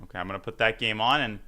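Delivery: normal talking voice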